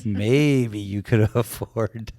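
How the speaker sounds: slow laughing jab; laughing mid-line. Retold or imitated. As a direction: let the laugh spill out while needling him. Mocking agent, laughs mid-line.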